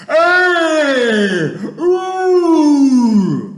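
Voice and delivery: huge high giant roar; long wailing howl at full volume. A narrator's reading. Giant caught in a nightmare, roaring. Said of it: "Roared the flesh lump eater".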